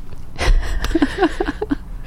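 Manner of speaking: airy silent laugh; soft breathy laugh. Real, not acted. Laughs at his own rant.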